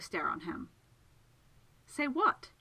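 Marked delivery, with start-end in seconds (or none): 1.9-2.5 high, puzzled question; honestly befuddled. Acted. Segundus cannot follow Miss Absalom's accusation, befuddled. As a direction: ask it with no idea what is meant. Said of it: "very honestly befuddled".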